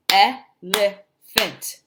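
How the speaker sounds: "elephant" slowly as el-e-phant, first beat strongest; syllable by syllable. Shown as a demonstration. Teacher modelling a new word, "elephant" slowly in syllables. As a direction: stretch "elephant" into three clear beats. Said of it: "How many syllables are in the words".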